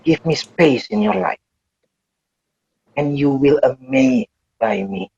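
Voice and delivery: slow, measured voice of God; slow, deliberate divine appeal; God speaking. Acted. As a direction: speak as God, drawing out each phrase. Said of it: "Bahkan dia bilang".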